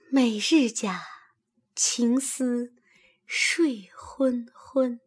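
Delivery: slow, drawn-out, soft near-whisper; long soft sigh. A narrator's reading. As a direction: sigh the words out languidly, fading away. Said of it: "细细地长叹了一声".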